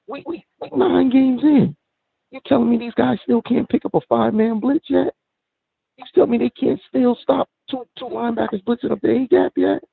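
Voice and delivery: high-pitched incredulous venting; exasperated disbelief. Real, not acted. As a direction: voice climbing in real exasperation. Fan venting about team's blocking.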